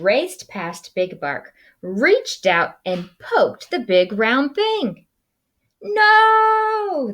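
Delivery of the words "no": high loud yell; very high shouted no; crowd yell. Acted. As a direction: yell no all together. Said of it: "the crowd yelled".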